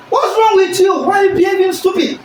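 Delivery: high, loud angry shouting at someone; angry scolding. Shown as a demonstration. Teacher acting out an angry person shouting. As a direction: snap at them like you're furious. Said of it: "Unlike the person that's very angry".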